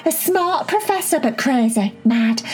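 put-on bubbly, high-pitched little girl; excited high girl voice. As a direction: squeal it out, eager and excited. Cherry voiced in a story.

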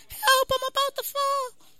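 put-on very high, slow child's squeal; kid on a seesaw. Kid on the seesaw calling dad, high put-on child voice. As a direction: pipe up as a little kid in trouble. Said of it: "the kid's on the seesaw like".

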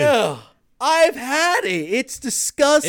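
high, loud, slow disgust. Real, not acted. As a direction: recoil at the thought of it. Disgust at cauliflower crust.

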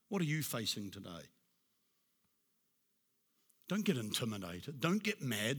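ordinary delivery

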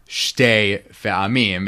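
shtei run together as one slow syllable. Shown as a demonstration. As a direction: say "shtei" with no vowel between sh and t. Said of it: "with one syllable".

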